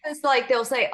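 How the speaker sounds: usual speaking voice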